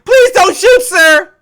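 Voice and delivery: high, loud, mock-panicked plea; hammed-up shouted begging; desperate plea. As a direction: cry it out high, begging for your life. Acted plea to police.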